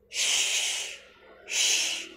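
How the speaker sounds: "sh" as a long voiceless hush. Shown as a demonstration. Teacher modelling a long sound for learners, drawn-out SH. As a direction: draw out "sh" softly, pure air. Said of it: "cái âm dài dài này".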